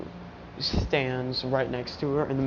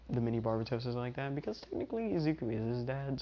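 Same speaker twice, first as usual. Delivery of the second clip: plain reading voice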